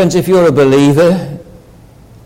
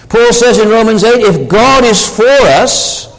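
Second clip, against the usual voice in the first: raised, ringing sermon voice with lifted pitch; fervent preaching; 7 semitones higher than usual. Acted. Preacher urging his congregation that God is their Saviour, fervent and raised. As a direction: press the good news home with rising conviction.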